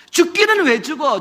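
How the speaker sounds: 죽기는 왜 죽어 shouted angrily; angry shout. Acted. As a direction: shout it at her. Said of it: "야곱이 역정을 내는".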